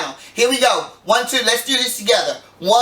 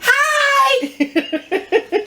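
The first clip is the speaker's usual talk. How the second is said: put-on childlike hi in a much higher voice; imitating Ellis; much slower than usual. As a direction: squeak hi like an excited granddaughter. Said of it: "How did she say it ... you do Ellis really well".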